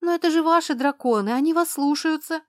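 high, loud jittery protest; a nervous girl pleading, high and loud; nervous outburst. A narrator's reading. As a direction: blurt it out on edge. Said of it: "Нервно дёрнулась она".

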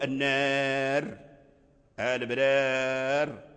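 النار and البرار drawn out very slowly, the final r silent and still; pausal form. Shown as a demonstration. Teacher showing students how to stop on the r, slow drawn-out recitation. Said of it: "لو وقفنا على النار فنقول".

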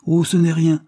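high, loud, airy dismissal; indifferent. A narrator's reading. Carlyle brushes off thanks for his gift, indifferent. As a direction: wave it away. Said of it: "reprit l'avoué avec indifférence".

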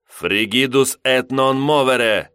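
slow, loud Latin spell; crisp incantation. A narrator's reading. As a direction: enunciate the church spell. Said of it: "чётко произнёс на тайно-церковном".

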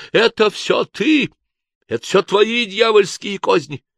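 high, loud, slow accusing yell; monk jumps up and yells at the commissar; accusing shout. A narrator's reading. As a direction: point and scream at the commissar. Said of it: "вдруг вскочил и закричал".

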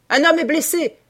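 high, loud alarm call; full-lunged shout. A narrator's reading. Hunter alerting the others to a wounded man, full-lunged shout. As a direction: yell for everyone to hear. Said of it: "cria-t-il de toute la force de ses poumons".

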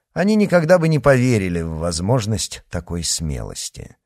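ordinary delivery

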